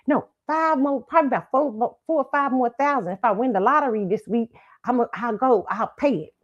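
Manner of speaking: normal talking voice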